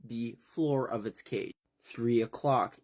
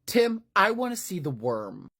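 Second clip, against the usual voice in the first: Kent's demand; 7 semitones higher, 4 dB louder than usual. Acted. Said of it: "Kent has to come in sideways and be like".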